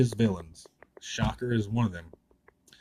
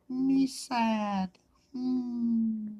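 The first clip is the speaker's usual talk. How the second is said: much higher, mock-pitiful little voice; silly sad voice; 13 semitones higher, much slower than usual. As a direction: play a sulky cartoon kid.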